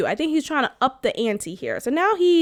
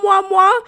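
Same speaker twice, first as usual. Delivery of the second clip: put-on high, smacking baby-kiss noises; imitating his kissing noises on her cheek, high smacks; imitated kiss smacks; 7 semitones higher, 6 dB louder than usual. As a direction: smack loud kisses like kissing a baby's cheek. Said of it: "imitate the noises".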